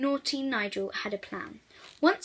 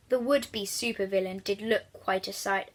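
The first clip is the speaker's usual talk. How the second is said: ordinary delivery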